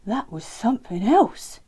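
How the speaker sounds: loud, amazed character voice; awed little friend; Len voice. A narrator's reading.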